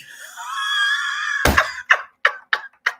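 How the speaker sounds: shrieking burst of laughter; helpless crying laugh; loud high laugh. Real, not acted. Cracking up at the clip.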